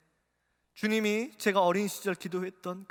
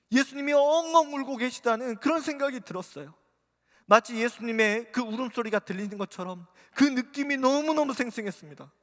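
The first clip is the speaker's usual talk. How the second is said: ordinary talk, nothing marked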